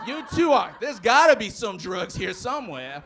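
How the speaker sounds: loud, accusing officer act; hammed-up cop, suddenly loud; mock cop outburst. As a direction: burst out like a suspicious lawman. Cop's suspicion erupts, acted.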